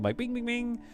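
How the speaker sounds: button bings. Retold or imitated. Imitated button sound.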